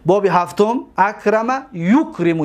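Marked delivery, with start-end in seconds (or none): 1.7-2.4 یکرم begun with yu; first syllable yu. Shown as a demonstration. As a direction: say یکرم as yukrimu, with u after the y. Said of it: "یکرمو نمیگو".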